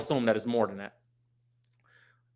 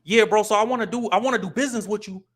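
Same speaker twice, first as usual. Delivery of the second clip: raised-pitch street talk in a business pitch; 7 semitones higher than usual. Shown as a demonstration. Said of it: "You can't talk like that".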